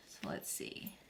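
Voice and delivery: very soft, under the breath; talking to herself. Real, not acted. Crafter checking a measurement, talking to herself under her breath. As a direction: murmur it while working.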